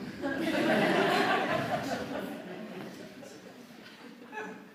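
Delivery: high airy laugh, rising; breathy laugh. Real, not acted. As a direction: giggle at the punchline. Laughing at tampon line.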